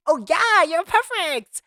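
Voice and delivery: high, loud gushing praise. Retold or imitated. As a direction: squeal it out to the phone. Cooing at dropped phone.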